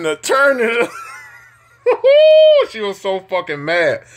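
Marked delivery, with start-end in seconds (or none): 1.8-2.7 high, loud squealing laugh; laughing so hard it squeaks; shrieking laugh. Real, not acted. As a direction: burst into a piercing cackle. Cracking up at the story.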